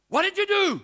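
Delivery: high, loud shocked yell; retold scream. Healed man screams at the couple who prayed. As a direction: scream it out, stunned. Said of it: "he screams at this guy".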